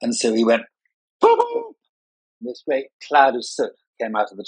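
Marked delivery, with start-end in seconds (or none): 1.2-1.8 very high hooted "boo, boo"; train whistle imitation. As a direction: hoot like a steam engine. Imitates train blowing out soot.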